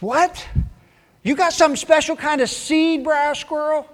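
a nosy little rabbit begging for secrets; pestering rabbit. Acted. Rabbit voiced pestering Squirrel.

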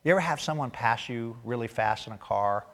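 ordinary talk

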